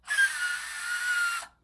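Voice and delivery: high, loud, long-held scream; a second try at a sustained high scream; demonstrated scream. Shown as a demonstration. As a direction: scream one drawn-out "Ah!". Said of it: "That's kinda good, but".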